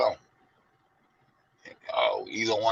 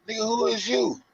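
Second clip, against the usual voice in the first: high, put-on voice; mock the guy who answers; voiced bystander; 12 semitones higher than usual. Reply to the lost man, high put-on voice. Voicing the other man's reply.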